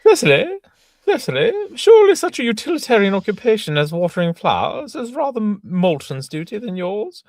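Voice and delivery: very high, loud, prim governess voice; Miss Prism. Acted. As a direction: scold her pupil in a shrill falsetto. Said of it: "Miss Prism".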